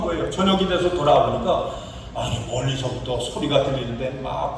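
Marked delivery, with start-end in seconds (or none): none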